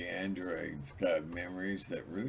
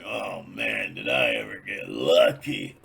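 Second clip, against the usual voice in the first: much lower, louder smug voice; low, loud self-satisfied voice; gloating man; 11 semitones lower, 9 dB louder than usual. Retold or imitated. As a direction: brag like a satisfied guy.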